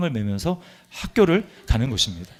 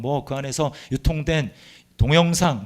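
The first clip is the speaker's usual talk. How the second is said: normal talking voice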